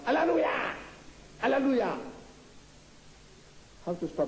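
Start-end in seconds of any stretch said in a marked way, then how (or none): 0.1-2.0 mock shout. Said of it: "all the Christians shout".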